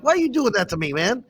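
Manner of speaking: a playful hurt cry at the friend; mock wounded outrage. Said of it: "I saw red".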